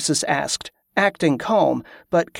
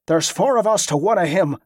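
snarled; 3 semitones higher than usual. A narrator's reading. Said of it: "the guard snarled".

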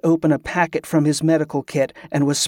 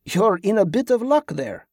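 higher-pitched soldier's reply; soldier figure, obliging; 5 semitones higher than usual. A narrator's reading. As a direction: answer as a helpful soldier.

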